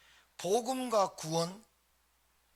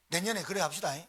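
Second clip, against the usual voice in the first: ordinary talk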